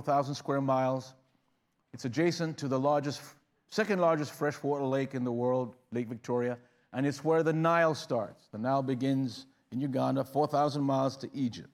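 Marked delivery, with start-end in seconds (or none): none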